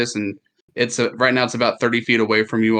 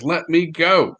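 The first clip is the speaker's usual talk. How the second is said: screaming; 8 semitones higher, 4 dB louder than usual. Acted. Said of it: "just screaming".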